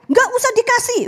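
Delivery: lightly voiced father saying no; mock father retort. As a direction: toss it off like an annoyed dad.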